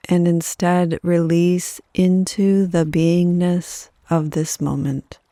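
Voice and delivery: unhurried yoga nidra guidance, letting each phrase settle; guided meditation. Acted.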